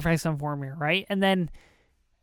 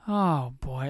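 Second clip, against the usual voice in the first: ordinary talk, nothing marked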